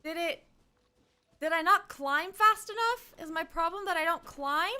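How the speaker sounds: frustrated rising questions; exasperated. Real, not acted. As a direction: whine at the game. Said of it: "frustrated climbing".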